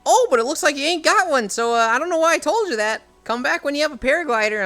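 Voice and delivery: chirpy shopkeeper voice, loud and high. Retold or imitated. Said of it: "he's like".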